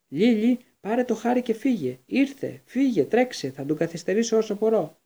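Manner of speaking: high, loud panicked cry; panicked shout. A narrator's reading. Father shouting to his wife to flee, panicked. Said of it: "να φωνάζει πανικόβλητη".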